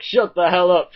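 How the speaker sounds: loud, high outburst; exasperated yell. Pretend. Telling the dub actor off, exasperated yell. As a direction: cut him off with a loud yell.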